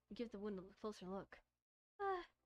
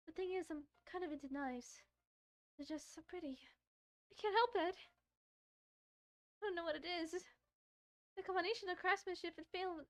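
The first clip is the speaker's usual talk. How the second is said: put-on higher, shy girl's voice; shy Yuri; 7 semitones higher than usual. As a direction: play the bashful knife lover.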